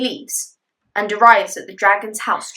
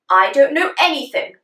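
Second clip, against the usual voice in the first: dismissive king; 5 semitones higher, 4 dB louder than usual. Acted.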